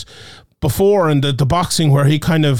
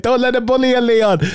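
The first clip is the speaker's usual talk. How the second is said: put-on Birmingham accent, pitched up; Brummie accent; 6 semitones higher than usual. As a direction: Leon in a Brummie voice. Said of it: "I could hear it in a Birmingham accent".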